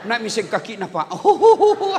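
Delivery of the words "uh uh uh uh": mock pregnant grunts. Mimicking pregnant woman's grunts.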